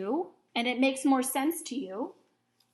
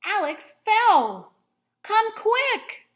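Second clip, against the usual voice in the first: loud, high urgent call for help; excited; 11 semitones higher, 9 dB louder, slower than usual. Shown as a demonstration. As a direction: cry out in alarm, much higher and louder. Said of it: "Do you hear the excitement in my voice?".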